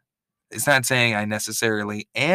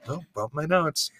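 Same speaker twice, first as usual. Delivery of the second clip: normal talking voice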